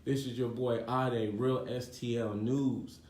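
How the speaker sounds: usual speaking voice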